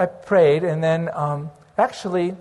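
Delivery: normal talking voice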